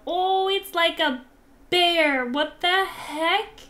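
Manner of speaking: loud high amazed exclaim; delighted surprise. Real, not acted. Seeing a bear-like dog, loud amazed delight. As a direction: shout in happy disbelief.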